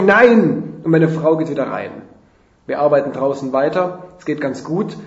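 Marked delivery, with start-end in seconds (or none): 0.0-0.8 loud, higher shouted refusal; Zurückrufen. A narrator's reading. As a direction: holler it back. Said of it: "Ich rufe zurück". (laut zurückgerufen)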